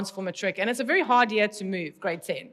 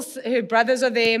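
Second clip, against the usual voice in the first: usual speaking voice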